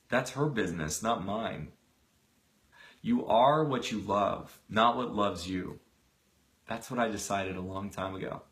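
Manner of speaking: a brother's calm, hushed wisdom. Acted. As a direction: settle the matter gently. Donald's quiet creed.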